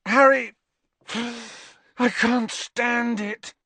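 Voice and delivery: high, slow, agonized cry; anguished outburst. A narrator's reading. Lovesick Ron confesses to Harry, anguished. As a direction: draw out the agony, pitched high.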